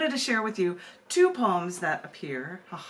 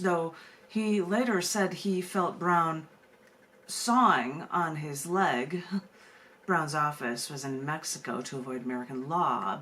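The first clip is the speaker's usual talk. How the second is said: measured, deliberate spoken-word delivery; deadpan performance poetry reading; poem reading; 4 semitones lower than usual. Acted. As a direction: perform the poem slowly, with dark humor.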